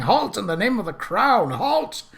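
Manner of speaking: a constable's high, stern shout. Acted. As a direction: bellow the order after the fleeing car. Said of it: "waving his hat and shouting".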